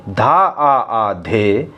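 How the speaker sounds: "धा आ आ धे" spoken very slowly, each syllable separate; slow teaching recitation of the tabla bols. Shown as a demonstration. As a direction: spell out the double आ slowly like a teacher. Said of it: "इस प्रकार से पढ़ना है इसको".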